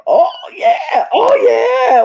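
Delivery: excited. Retold or imitated. Fan retelling their reaction to the twist, excited. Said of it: "I got excited".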